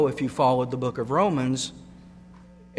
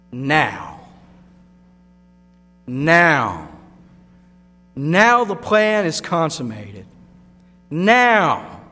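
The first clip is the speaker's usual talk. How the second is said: repeated now, rising louder toward a peak; preaching build; 5 dB louder, much slower than usual. Acted. Preacher building to a peak before the congregation. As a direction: hammer the word home, louder each time.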